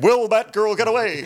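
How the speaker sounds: high, loud cliffhanger narrator; dramatic announcer voice. Pretend. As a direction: hype it like a serial narrator. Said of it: "Mo will be like".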